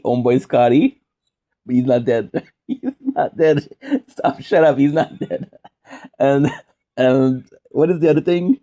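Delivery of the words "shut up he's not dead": normal talking voice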